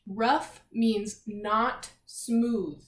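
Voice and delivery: rough said very slowly and firmly; defining slowly. Shown as a demonstration. Teacher defining the vocabulary word rough, very slow. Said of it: "vocabulary word".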